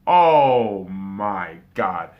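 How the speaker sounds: loud, low, very drawn-out amazement; stunned disbelief. Real, not acted. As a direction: stretch every word in heavy disbelief. Stunned by the Browns' win.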